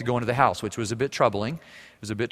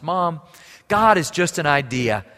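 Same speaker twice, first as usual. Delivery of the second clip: louder, heated protest, last words slowed; defiant daughter; 4 semitones higher, 8 dB louder than usual. Retold or imitated. Daughter rejecting God to her mother, heated. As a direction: throw the disbelief back at mom, hot.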